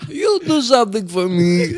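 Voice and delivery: high Gru impression with heavy accent. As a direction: do Gru from Despicable Me. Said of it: "That's really well done".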